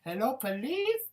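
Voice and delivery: lightly voiced Karen; mock Karen. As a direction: play a fussy Karen dialing the cops. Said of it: "That's all Karen need".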